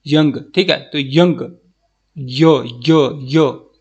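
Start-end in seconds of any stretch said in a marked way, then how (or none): 2.2-3.7 put-on slow, drawn-out "यो" as the opening of young; sounding out the start. Shown as a demonstration. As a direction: stretch "यो" slowly to show the sound. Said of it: "कैसे बोलेंगे".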